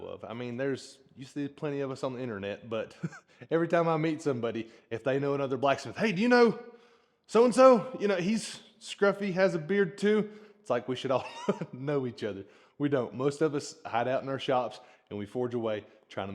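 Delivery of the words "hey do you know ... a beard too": high, loud imitation of an eager stranger; hammed-up excited questioning, pitched well up; eager mock inquiry. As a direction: pipe up loudly, imitating an excited acquaintance. Mimics people asking about fellow blacksmiths.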